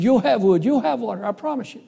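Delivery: high-pitched, eager pleading; desperate promise. Retold or imitated. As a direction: voice a boy anxiously promising. Boy desperately promises the mean man.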